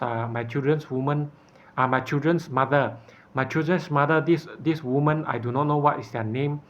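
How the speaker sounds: normal talking voice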